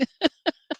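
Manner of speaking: short high laugh; high giggle. Real, not acted. Giggle after whispering.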